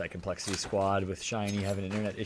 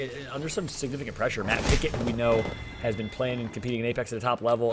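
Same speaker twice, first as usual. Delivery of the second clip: ordinary talk, nothing marked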